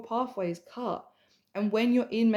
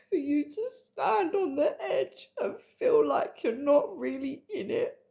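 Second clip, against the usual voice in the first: tearful laugh-cry; 4 semitones higher than usual. Real, not acted. Emotional coaching, crying.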